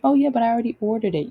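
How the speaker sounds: fast, high, offhand put-on voice of the ex; mock ex brushing her off; imitated ex. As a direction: rattle it off like a careless boyfriend. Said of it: "retorted ex quote".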